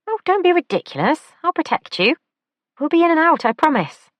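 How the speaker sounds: high, lively camp man; camp male voice. A narrator's reading. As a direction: lilt it up, high and playful. Character voice in audiobook.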